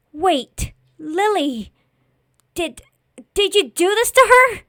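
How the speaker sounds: high, loud, halting accusation; horrified accusation. Acted. Boy confronts his friend over Lily's death, horrified. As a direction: break off, then cry it out high and loud.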